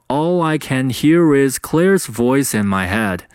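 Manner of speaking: "All I" and "voice in" run together; unbroken teaching read, linking "All I" and "voice in"; linked-up sentence. Shown as a demonstration. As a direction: link "hear is" and "voice in" without breaks. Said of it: "连在一起就应该读成".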